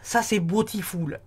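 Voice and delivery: beautiful said the English way, slow and drawn out; a hammed-up English accent on beautiful; mock English accent. Tarot reader hams an English accent for viewers, mock English accent. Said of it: "je vous ai fait un magnifique, euh, accent anglais".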